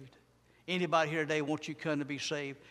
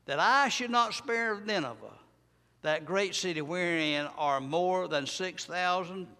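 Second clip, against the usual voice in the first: preaching scripture; 3 semitones higher, 5 dB louder than usual. Acted. Preacher reading Jonah to his church, loud and rising. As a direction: proclaim the verses to the congregation.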